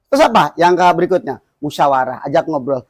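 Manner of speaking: ordinary talk, nothing marked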